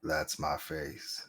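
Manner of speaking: very low, slow growl; Vin Diesel voice. Pretend. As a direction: drag it out deep and low. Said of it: "you can actually just do Vin Diesel".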